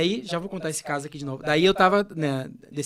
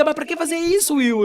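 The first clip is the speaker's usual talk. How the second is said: half-laughing retold annoyance, higher and louder; irritated protest; 9 semitones higher, 6 dB louder than usual. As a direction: complain to a friend who keeps hugging you. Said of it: "E eu ficava irritado, bravo". (protesto irritado, mais agudo e mais alto)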